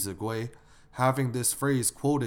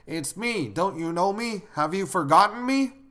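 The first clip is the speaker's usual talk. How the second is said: high-pitched caller, growing louder as they insist; unidentified caller; 8 semitones higher, 5 dB louder than usual. Acted. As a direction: press them to recognize you, pitched up high. Caller who won't give a name.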